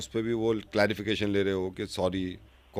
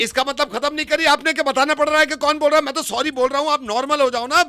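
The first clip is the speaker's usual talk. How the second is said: high and loud protest; mock indignant; 14 semitones higher, 4 dB louder than usual. Prank caller insists his sorry should end it, loud high protest. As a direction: act wounded and louder. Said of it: "ये, ये चिल्ला क्यों रहे हो?".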